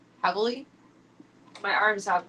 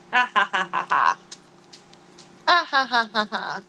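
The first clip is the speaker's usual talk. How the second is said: mock laugh; 4 dB louder than usual.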